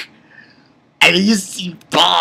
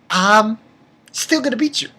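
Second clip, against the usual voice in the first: mock boast; 6 semitones higher than usual. Retelling rival's defiant boast.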